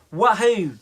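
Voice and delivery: loud, high whoop of joy; excited cheer. Acted. Jack cheers at being made captain, loud and high. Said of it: "waving his arms in excitement".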